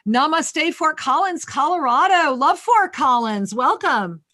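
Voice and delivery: beaming radio host greeting the caller; excited greeting. Real, not acted. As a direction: call out a big on-air welcome. Host loudly greets caller on air.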